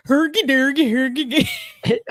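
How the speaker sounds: loud mock Swedish chef gibberish; Swedish chef impression. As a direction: ham up the Muppet chef's babble. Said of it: "talk like".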